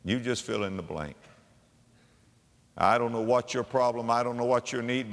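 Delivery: usual speaking voice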